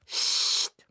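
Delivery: a sharp unvoiced shush; shushing hiss. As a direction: hush him in church. Wife hushing husband.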